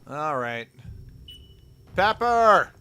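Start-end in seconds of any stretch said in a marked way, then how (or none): 1.9-2.7 high, loud barking drill commands; drill sergeant. Acted. Drill sergeant calling his squad to march in step.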